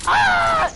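sudden high-pitched cry; high startled yelp. Real, not acted. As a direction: yelp as if hit.

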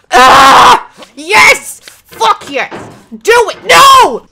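high, loud cheering that flips to a cry; root for yourself at full volume; excited shouting. Real, not acted. Gamer cheers then screams as he slips.

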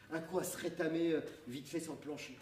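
usual speaking voice

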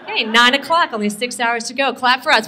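usual speaking voice

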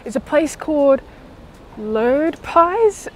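muffled behind surgical mask; speaking with covered mouth; through a face mask. Real, not acted. Seen 0: Blue surgical mask covers her mouth whenever she is on screen.

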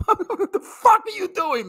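cracking up at ordering steak at Applebee's; laughing disbelief. Real, not acted.

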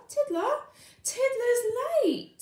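high, loud call for Tiddler; teacher calling out. Acted. Miss Skate calling the register, high and loud.

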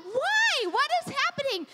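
high-pitched, exasperated retold questioning; mock alarm. Mom demanding answers from the babysitter, acted alarm.

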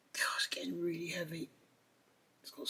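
whispered under the breath; whispering. Real, not acted. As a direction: whisper it to yourself while straining. Fiddly part, muttering to self.

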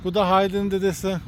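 ordinary talk, nothing marked

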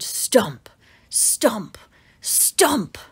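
hushed whisper for the thumping footsteps; whispered stomps. A narrator's reading. Narrator to children, whispering the dinosaur's stomps. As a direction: breathe the stomps out as a whisper, building suspense. Sound effects in bedtime story.

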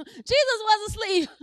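wake-up shout. Real, not acted. Preacher making sure the church is not asleep, loud wake-up call. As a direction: jolt the congregation awake. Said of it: "Just making sure y'all not asleep".

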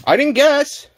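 very high, loud protest; outraged high-pitched denial; indignant yelling. Real, not acted. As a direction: yelp it out defensively. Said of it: "Can you stop yelling".

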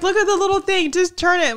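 put-on high coaxing voice to a child; coaxing mom. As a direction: talk a little kid through unlocking it, up high. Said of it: "I was like".

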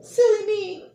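high, loud, squeaky anteater. Acted. Anteater voiced in story.

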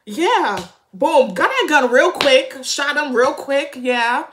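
loud excited cheering; shrieking jackpot winner. Real, not acted. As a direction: yell it out in triumph. Cheering the shooting scene.

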